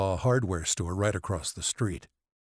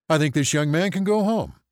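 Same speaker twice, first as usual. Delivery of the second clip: raised pitch, louder, indignant; affronted ER physician; 8 semitones higher, 7 dB louder than usual. A narrator's reading. ER physician objecting to the family doctor, indignant. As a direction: assert your rank, affronted.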